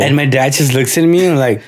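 ordinary talk

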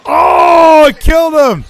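high, loud ringside outburst; excited shout. Real, not acted. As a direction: yell it like the move just landed. Reacting to a wrestling spot.

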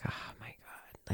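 whispered in dawning realisation; slow, barely audible retold whisper; hushed whisper. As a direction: breathe it out slowly in a whisper. Retold moment of despairing realisation.

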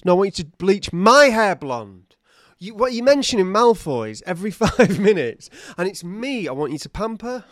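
loud, high diva demand; hammed-up vain outrage; indignant. Acted quarrel with house-elf.

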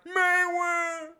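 high, drawn-out monster cry; Loch Ness Monster call. Acted. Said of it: "what noise do you think the Loch Ness Monster makes".

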